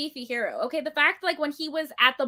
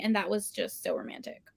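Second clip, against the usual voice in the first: dreamy whisper; 6 semitones lower, 6 dB quieter than usual. Real, not acted. Gushing over the romantic hero.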